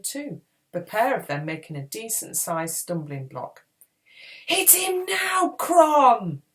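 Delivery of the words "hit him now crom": high, drawn-out loud battle cry; shouting. A narrator's reading. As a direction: shout the urgent order with full force. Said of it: "Podkin shouted".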